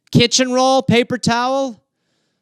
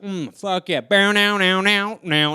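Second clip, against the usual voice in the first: stoner passing a joint; slower than usual. Retold or imitated. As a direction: pass it like a joint at Woodstock. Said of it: "you're like".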